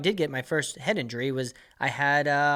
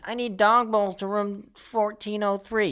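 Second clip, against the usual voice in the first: high, slow, whiny guest voice; mock the entitled dog owner asking front desk; whiny mock guest request; needy guest; 6 semitones higher, slower than usual. Mocking needy hotel guest.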